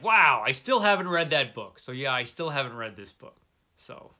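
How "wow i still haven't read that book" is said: louder self-surprised exclamation; retold surprise. Finding the old book in his closet, loud surprise. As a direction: exclaim it, amazed at yourself. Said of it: "And I'm like".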